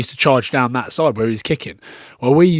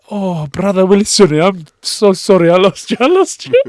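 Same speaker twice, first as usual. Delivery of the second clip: apologetic Fijian mate; 7 semitones higher, 7 dB louder than usual. Retold or imitated. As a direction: ham up the sorry Fijian brother's apology. Drunk Fijian friend apologising, voiced.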